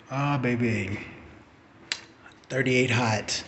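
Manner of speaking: usual speaking voice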